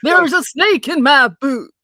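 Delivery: loud, drawn-out cartoon cowboy voice; Woody. Quoting a toy cowboy to the hosts, hammed-up cowboy impression.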